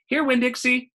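high, loud call to a dog. Acted. Opal calling the dog across the store, high loud call. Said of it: "I said".

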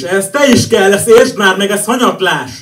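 loud, high slam-poem outburst; emphatic outburst. Acted. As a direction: burst out loudly, pitch jumping up. (hangos, indulatos kifakadás)

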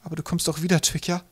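high, uncertain voice; hesitant, high-pitched pleading question; unsure plea. A narrator's reading. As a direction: seek reassurance anxiously. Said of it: "Unsicher fügte sie hinzu".

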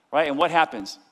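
usual speaking voice

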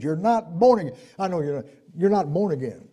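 ordinary talk

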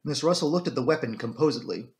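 ordinary delivery

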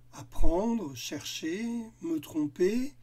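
Apprendre blurted loud, the rest anxious; a monster listing his fears; fearful listing. Acted. Monster Cole confessing his school fears, anxious. Cole lists what scares him.

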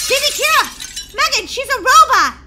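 high, loud alarm; urgent warning. Acted. Molly warning freed baby about the robot, alarmed. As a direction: blurt out the danger loudly.

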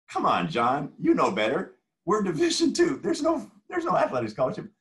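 loud, high-pitched rebuttal, imitating the coach; mock exasperated protest. Coach rebuts a rival's accusation by phone, retold in exasperation.

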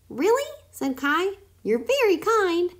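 very high, sweetly innocent; innocent guinea pig. A narrator's reading. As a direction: play it sweet and wide-eyed. Kai fakes gratitude.

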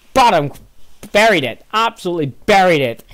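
high, loud punched phrases; exultant commentator's replay; emphatic goal celebration. Real, not acted. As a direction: hammer home how well it was finished. Savours the goal just scored.

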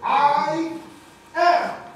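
I am, both words loud and high, proclaimed; booming declaration. Shown as a demonstration. Preacher proclaiming divine identity, booming and loud. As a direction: proclaim I am with the power of the universe. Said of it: "preacher repeats key words".

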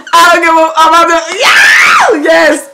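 very high, loud laughing shout; high loud excited shriek; hyped laughing scream. Real, not acted. Hyped reacting to song intro.

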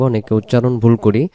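ordinary delivery

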